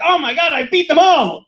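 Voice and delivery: high, hammed-up triumph; mock victorious exclaim. Imitating a tripper fighting shadows, hammed-up triumph. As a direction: gloat like you beat an army. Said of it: "and you're like".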